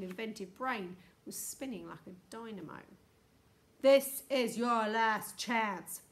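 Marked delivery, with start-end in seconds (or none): 3.8-6.0 slow, very loud ultimatum; booming warning. A narrator's reading. Houston warns the silent strangers, slow booming voice. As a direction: boom each word out deliberately. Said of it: "boomed the voice".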